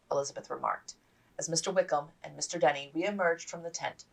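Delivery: ordinary delivery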